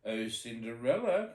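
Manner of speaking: calling out. Acted. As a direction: call her down sweetly. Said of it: "called her stepmother".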